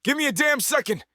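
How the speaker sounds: high irritated yell; shouting back. A narrator's reading.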